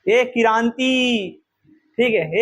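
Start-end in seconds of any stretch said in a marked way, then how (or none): 0.1-1.3 slow, drawn-out call of ए किरांति; ए held long like calling across a yard. Shown as a demonstration. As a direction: call a student's name, stretching it. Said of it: "जब थोड़ा सा पुकार के बोलोगे".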